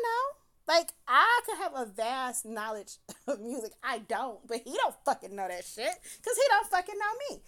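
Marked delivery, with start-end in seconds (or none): none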